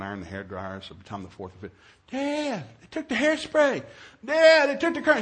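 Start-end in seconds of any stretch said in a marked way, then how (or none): 2.1-5.2 high-pitched mock complaint; whining daughter. Daughter reporting stolen hairspray to dad, whining. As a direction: wail to Dad like a wronged kid.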